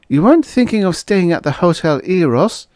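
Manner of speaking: high female voice, faint disgust; edged with repugnance. A narrator's reading. Waitress asks about his staying at the Eros, repugnance. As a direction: ask as if the idea repels you. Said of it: "in a voice edged with repugnance".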